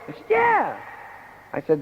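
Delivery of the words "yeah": high visitor voice. Acted.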